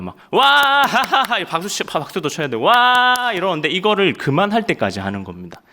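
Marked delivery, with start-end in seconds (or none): 0.3-1.4 loud high whoop into laughter; hammed-up drill laughter; forced laughing. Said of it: "진짜 웃는 겁니다". 2.6-3.3 high, loud whoop; mock soldiers' cheer; drill cheer.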